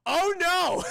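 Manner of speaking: high, loud mock dismay; big hammed-up wail of dismay; alarmed outcry. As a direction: cry out in exaggerated alarm. Acting Paul's alarmed reaction.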